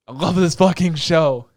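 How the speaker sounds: loud, slow, heartfelt declaration; loud fan devotion, stretched out; emphatic gushing. Real, not acted. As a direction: belt it out word by word. Gushing after saying he's crying.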